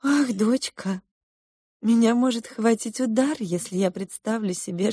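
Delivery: lightly voiced cook mother. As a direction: explain gently to a child. Cook mother frets about rank.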